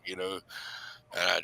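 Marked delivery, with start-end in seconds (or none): none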